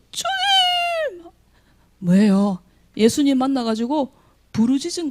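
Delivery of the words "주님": very high, drawn-out weeping cry; wailing cry. Retold or imitated. The desperate mother crying out to the Lord, wailing. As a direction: wail the word like sobbing. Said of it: "울면서 얘기했을 거예요".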